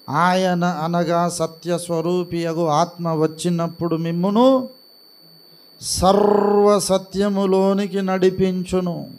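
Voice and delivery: measured, rising, deliberate recitation of scripture; solemn scripture reading. Acted. Preacher reads John sixteen to the church, solemn recitation. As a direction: intone the verse as holy words.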